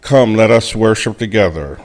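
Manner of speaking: ordinary delivery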